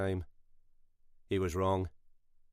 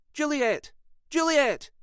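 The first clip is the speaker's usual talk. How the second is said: high call, louder on the second; calling sharply; 3 dB louder, slower than usual. A narrator's reading. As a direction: call the dog back, impatient. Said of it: "Sylvia snapped at him".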